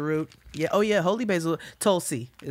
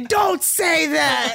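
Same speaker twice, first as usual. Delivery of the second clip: high, slow, loud whiny protest; childish shout; 12 semitones higher, 6 dB louder, much slower than usual. Retold or imitated. A child yelling at her teasing father, high and drawn out. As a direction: wail it like an annoyed kid. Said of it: "And I would say".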